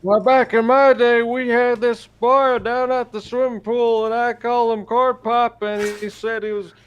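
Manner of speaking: high, loud old president impression; old politician rambling at the pool; presidential voice. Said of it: "That's my, uh, presidential voice".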